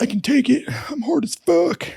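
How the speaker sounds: high, strained macho bravado; tough guy boast. Retold or imitated. Freezing guy to instructors, high strained boast. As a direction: play the shivering guy insisting he's tough. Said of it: "he's like".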